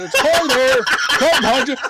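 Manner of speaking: high, loud warning shout with a laugh. Retold or imitated. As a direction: yell it to the driver laughing. Kids warning the driver.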